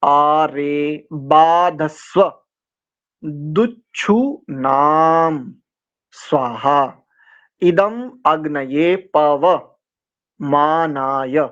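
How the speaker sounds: आ रे बाधस्व with आ and रे held long; slow long vowels. Shown as a demonstration. Teacher shows the students each long vowel, slowly. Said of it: "आ भी दीर्घ है".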